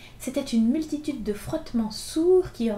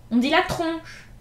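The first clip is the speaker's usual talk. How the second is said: louder and higher, a correcting shout; cry out; 7 semitones higher, 7 dB louder, slower than usual. A narrator's reading. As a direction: bark the correction at the boy. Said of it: "cria Gavroche". (Gavroche crie au petit pour le corriger, fort et aigu)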